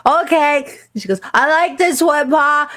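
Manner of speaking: put-on very high, sweet child's voice; little girl voice. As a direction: squeak it like a small girl.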